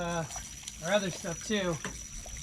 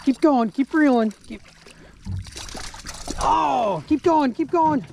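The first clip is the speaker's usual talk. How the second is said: fighting a fish; 5 semitones higher, slower than usual. Real, not acted. Coaching son reeling in fish.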